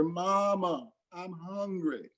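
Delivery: put-on high pleading little child's voice; crying child. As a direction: beg like a hungry small kid. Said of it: "crying out louder and louder".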